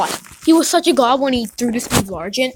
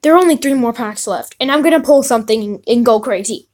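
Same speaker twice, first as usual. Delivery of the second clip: normal talking voice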